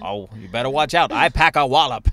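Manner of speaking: loud, old-timey cartoon threat; 1940s cartoon tough guy. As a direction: ham up a vintage cartoon bruiser. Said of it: "nineteen forties cartoon".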